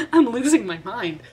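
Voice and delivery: loud frazzled cry; exasperated outburst. Real, not acted. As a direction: blurt it out like you're at your wits' end. Exasperated outburst after laughing.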